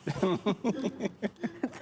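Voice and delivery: breaking into laughter after holding out; helpless laugh at a punchline; real burst of laughing. Real, not acted. Listener cracks up at punchline.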